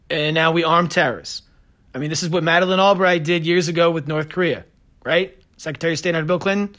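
normal talking voice

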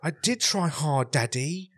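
put-on high, pleading little-boy voice; whiny posh kid. Imitated kid protesting to his daddy, high whine. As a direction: whine upward like a protesting child. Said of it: "Like, that's how he was talking".

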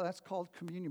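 ordinary talk

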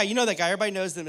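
ordinary talk, nothing marked

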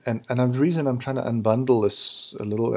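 ordinary talk, nothing marked

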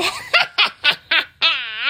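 high, rattling witch cackle; witch's laugh. A narrator's reading. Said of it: "The witch laughed out loud".

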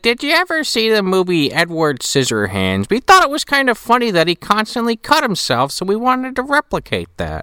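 high granny explaining; old lady voice. Retold or imitated. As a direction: explain innocently as the old woman. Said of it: "She's like".

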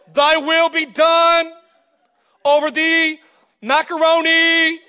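high, slow, grandly intoned mock prayer; hammed-up holy voice, stretching every word; pompous prayer voice. Mocking a friend who prays over New Year's dinner in a lofty put-on voice. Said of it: "why are you speaking like that?".

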